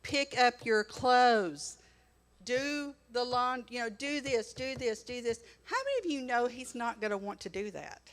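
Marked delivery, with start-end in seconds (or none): 0.0-5.4 loud, drawn-out nagging orders. Acted. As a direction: rag on him, dragging out each demand. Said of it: "if you're constantly ragging on them".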